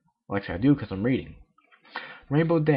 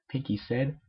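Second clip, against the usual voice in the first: plain reading voice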